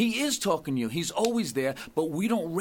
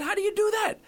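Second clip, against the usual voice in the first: much higher, puzzled penitent; baffled mock question; faster than usual. Penitent asks priest for instruction, baffled. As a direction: act out bewilderment, pitched way up.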